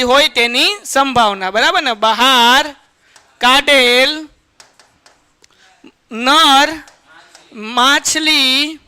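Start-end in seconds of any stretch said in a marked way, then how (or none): 1.9-8.8 બહાર કાઢેલ નર માછલી spelled out word by word with pauses; slow dictation. Shown as a demonstration. Teacher dictating to the class slowly, pausing after each word. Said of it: "બસ એટલું રાખીએ".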